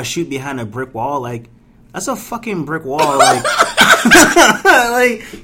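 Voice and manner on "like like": bursting into high laughter; cracking up mid-rant; laughing. Real, not acted. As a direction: laugh through the word. Laughing at brick wall shoots.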